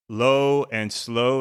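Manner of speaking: drawn out slowly; slow and low. Shown as a demonstration. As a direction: let each word stretch, unhurried. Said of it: "low in the diaphragm and slow breathing".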